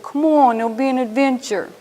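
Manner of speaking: put-on coaxing New Jersey accent, lightly done. Husband urging wife to move to Tennessee, New Jersey accent. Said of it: "In his best New Jersey accent".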